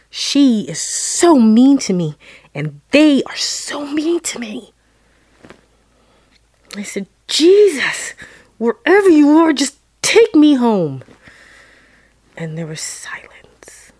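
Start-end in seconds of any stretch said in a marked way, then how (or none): 0.1-4.7 mild retold yell, stretching the hurt words. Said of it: "I stood there and I yelled". 7.3-11.0 high, loud plea to Jesus; retold yell. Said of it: "I stood there and I yelled".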